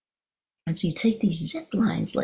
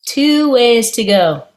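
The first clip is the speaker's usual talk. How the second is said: laughing punchline; 5 semitones higher, 8 dB louder than usual. Real, not acted. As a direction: land the joke through laughter. Punchline of bungee story.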